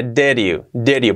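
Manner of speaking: "Did you" run together as "deriu"; linked, soft R. Shown as a demonstration. Teacher explaining the link, soft-r "Did you". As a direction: tap an r where "Did" meets "you". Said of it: "porque lo unimos con la vocal siguiente".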